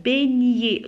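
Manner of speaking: "beignet" with gn as one ny glide; correct gn sound. Shown as a demonstration. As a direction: say "beignet" with the g silent, like bè-nyé. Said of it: "mais on dit beignet".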